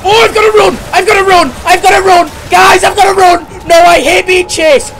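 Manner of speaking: high, loud panicked yell; panicked shouting. Real, not acted. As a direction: scream as if running for your life. Chased by monster, panicking.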